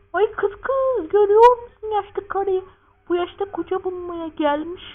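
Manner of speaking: high falsetto woman's voice; gossiping woman. Acted. Woman gossiping about an old woman seeking a husband, mock scandalised.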